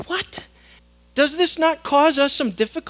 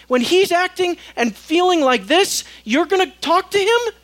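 incredulous; 6 semitones higher, 6 dB louder than usual. Acted. Preacher incredulous at Moses.

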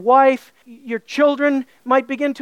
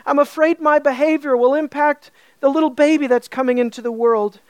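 ordinary talk, nothing marked

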